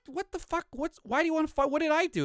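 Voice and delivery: high-pitched, bewildered mock protest; baffled protest. Stranger told he'll get beaten up, high baffled protest. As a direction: imitate a confused man in a high pitch.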